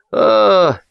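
high "ah!"; disgusted dismissal. A narrator's reading. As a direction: wince it away with one cry. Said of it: "сморщился Кытин".

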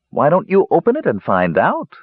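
high, teasing giggly woman; playful voice behind a door; laughing woman's voice. A narrator's reading. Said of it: "called a laughing voice".

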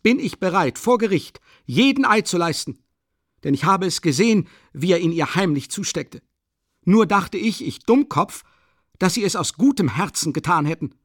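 high, loud furious testimony. Acted. Witness exposes the hidden handoff to all, furious. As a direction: pour it out at a high pitch, swearing to it. Heated accusation scene.

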